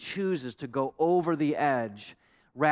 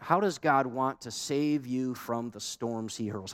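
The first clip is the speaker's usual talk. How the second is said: normal talking voice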